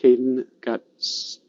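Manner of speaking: halting, with a long block before the last word; stuck mid-word. Acted. Storyteller acts out Caden's block as he reads, halting. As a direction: catch on the start of "stuck" and hang there.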